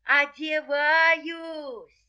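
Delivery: high, very drawn-out cry; frightened fox. Acted. Fox stalls the rooster, frightened. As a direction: stretch the word out high. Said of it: "Лиса опять говорит".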